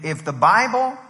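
ordinary talk

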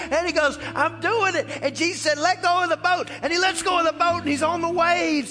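high, driving sermon storytelling; full-force preaching. Acted. Preacher acts out Peter leaving the boat, full-force preaching.

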